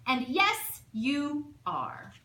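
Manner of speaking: lightly voiced bossy aunt laying down the rule; big blustery voice. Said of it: "had big blustery voices".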